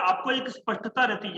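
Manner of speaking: normal talking voice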